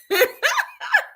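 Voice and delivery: squealing laugh at the joke; high laugh. Real, not acted. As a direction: burst of high-pitched laughter. Laughing at the nickname.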